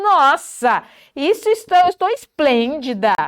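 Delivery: exclamação animada e mais aguda; elogie-se com entusiasmo; elogio enfático. Shown as a demonstration. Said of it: "dar ênfase nas palavras positivas". (admiração exagerada por si)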